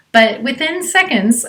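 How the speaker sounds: normal talking voice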